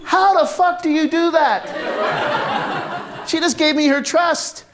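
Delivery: high, slow, laughing through the words; laughing in disbelief. Real, not acted. Storyteller marvels at Lucy's trust, laughing.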